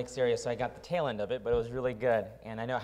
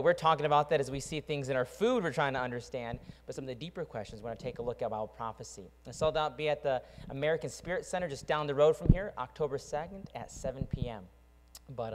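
ordinary talk, nothing marked

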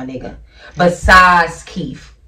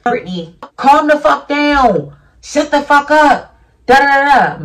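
loud, higher angry snapping; angry yelling; 8 semitones higher, 4 dB louder than usual. Retold or imitated. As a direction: yell it at her, high and loud. Retelling Brittany's rude shout.

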